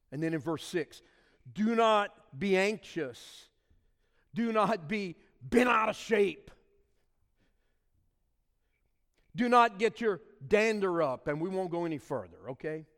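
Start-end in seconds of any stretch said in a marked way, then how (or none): 1.5-11.3 slow, louder, pressing each "not"; forceful scripture reading. Acted. Pastor urging the church not to be anxious, forceful and slow. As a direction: drive the warning home. Preacher reads verse forcefully.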